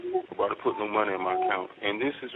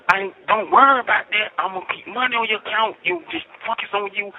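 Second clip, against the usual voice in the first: higher, sing-song mimicking of her promises; mocking imitation; 10 semitones higher, 3 dB louder than usual. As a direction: mimic her empty reassurance. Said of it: "you were like".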